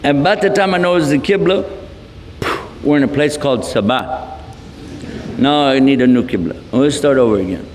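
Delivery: ordinary talk, nothing marked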